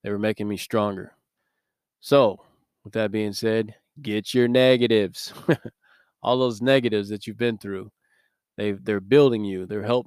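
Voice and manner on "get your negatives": slow, louder Southern drawl of a gym coach; Southern coach accent. Retold or imitated. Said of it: "echoing the coach catchphrase".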